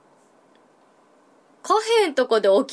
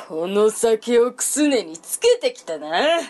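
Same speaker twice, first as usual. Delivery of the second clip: put-on stern old monk; slower than usual. Old monk's slow line.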